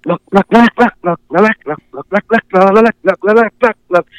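high bouncing honks, up and down; goose call imitation. Shown as a demonstration. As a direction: voice the goose calls, jumping pitch. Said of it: "just bouncing up and down in volume".

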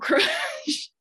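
normal talking voice

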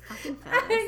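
high, laughing mock curse; laughing exasperation. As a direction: spit it out through laughter.

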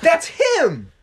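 very high, loud mock shout; mock outcry. Imagined listener recognises the egg culprit, mock outcry. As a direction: cry out as if spotting the culprit.

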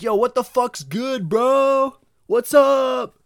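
high, slow, booming bro holler; over-casual loud greeting. Acted. Bro greeting at an interview, hollering. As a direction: draw it out, shouting like a hyped-up bro. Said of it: "like, an interview or something. I'd never be like".

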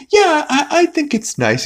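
very high, stammering reply; hesitant squeaky agreement; mock nervousness. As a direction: imitate a timid little kid. Stewie nervous before the bigger kid.

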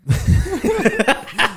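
cracking up loudly; loud high laugh. Real, not acted. Laughing at own bit.

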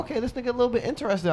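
high, fast mocking inner voice. Telling friends his inner thought in a high squeak. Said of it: "So I'm like".

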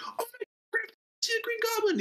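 high, fast squeal of fan excitement; excited fan shout. Retold or imitated. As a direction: squeal like a thrilled audience member. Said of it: "being like".

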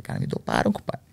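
breathy hushed whisper; hushed aside to a buddy, half a whisper. Retold or imitated. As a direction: whisper it like a secret. Telling friend he was drugged.

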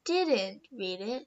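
usual speaking voice

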